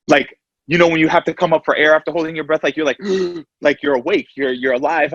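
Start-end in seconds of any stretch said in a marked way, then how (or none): 3.0-3.6 a high, sudden gasp for air; sharp gasp. Retold or imitated. As the OR doors open, sharp gasp for air. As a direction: gasp like surfacing after holding breath.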